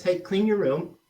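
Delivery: "Hey, clean your room" lifted high, unsure; upswing. Shown as a demonstration. Coach acting a parent's hesitant request to kids. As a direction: put a rising lilt on "clean your room". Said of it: "when you have a little upswing in your voice".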